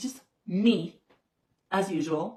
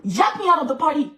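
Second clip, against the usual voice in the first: louder, higher burst of outrage; indignant outburst; 7 semitones higher, 8 dB louder than usual. Real, not acted. Telling fans how she got thrown out, loud and indignant. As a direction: blurt it out, still stung by the insult. Angry retelling of being kicked out.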